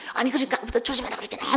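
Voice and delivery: high, rapid-fire mock scolding; angry outburst. Husband scolding wife for cutting her finger, angry. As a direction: imitate a sudden burst of temper. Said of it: "갑자기 소리를 버럭 질러요".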